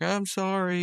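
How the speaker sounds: high-pitched imitated apology; Rigby apologizing. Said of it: "he's like".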